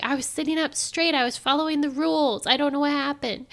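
replaying her baffled thoughts; confused inner panic. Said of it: "in my head, I'm like".

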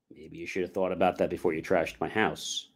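low, soft imagined retort; understated reproach, kept low; dry comeback. Retold or imitated. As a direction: deliver the comeback quietly and low.

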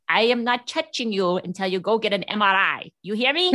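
stern order in a put-on Russian accent; thick Russian accent. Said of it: "in a thick Russian accent".

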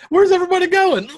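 very high, comic little voice; high-pitched talking-body-part voice; penis impression. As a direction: call out squeakily like a tiny creature. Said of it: "That's my penis impression".

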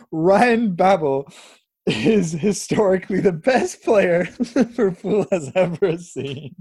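trying to keep a straight face; words breaking into laughter; laughing. Real, not acted. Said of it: "I can't say it with a straight face".

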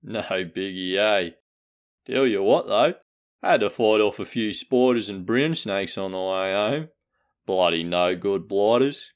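lightly hammed-up broad Australian bloke; Australian ocker accent. Podcast host greets listeners as a lightly voiced Australian bloke. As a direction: play a laid-back Aussie larrikin, half-joking.

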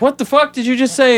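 high incredulous mock question; baffled reporters. Reporters reacting to Barry's nonsense, high mock disbelief. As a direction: squeak out the disbelief. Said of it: "And they're like".

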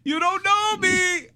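high, loud, drawn-out taunt; hammed-up defiant cry; mock defiance. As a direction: squeal it out as a playful challenge. Acting out a playful taunt.

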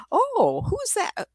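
high, surprised gasp of a question; startled question. Retold or imitated. Client startled on hearing of the spirit, high and surprised. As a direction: sound caught off guard.

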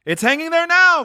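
put-on high, loud boyish call; boy's voice. Boy answering about the turkey, high loud boyish call. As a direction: shout it up as a lad. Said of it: "replied the boy".

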